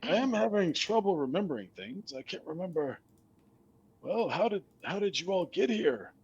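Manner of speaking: high, wavering forgetful skeleton. Acted. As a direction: play a confused old skeleton.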